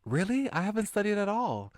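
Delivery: high, bright schoolgirl greeting; girl character voice. Acted. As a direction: sing out a cheery hello to a classmate. Same girl character panicking about test.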